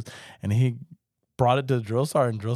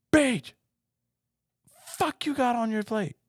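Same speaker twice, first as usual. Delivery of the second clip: drill sergeant. Retold or imitated. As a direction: bark it like a furious drill sergeant. Recruit retells sergeants yelling.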